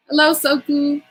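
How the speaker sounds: ordinary talk, nothing marked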